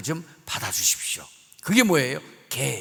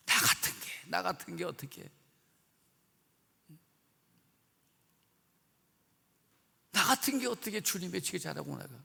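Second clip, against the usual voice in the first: soft broken murmur of self-blame; quiet grieving self-reproach; 2 semitones lower, 12 dB quieter than usual. Acted. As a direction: hush it like a man ashamed. Peter reproaches himself.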